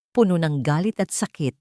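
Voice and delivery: plain reading voice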